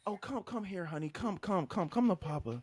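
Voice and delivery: high, soft cooing; mock consoling. Man calls crying girlfriend in for a hug, mock cooing. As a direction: coax gently with hammed-up tenderness.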